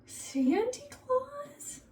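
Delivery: put-on very high, very slow wondering kid voice; awed child. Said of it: "the kids be like".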